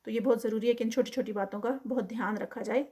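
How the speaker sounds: ordinary talk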